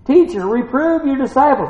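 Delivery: high acted complaint; objecting Pharisee. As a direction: scold as a scandalised Pharisee. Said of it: "some of the Pharisees from the song said".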